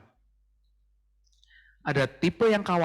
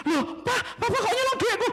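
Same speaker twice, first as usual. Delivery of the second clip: very high squeal of outrage; squeaky outraged wife, half laughing; shocked wife. Acted. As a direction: shriek at the stolen money.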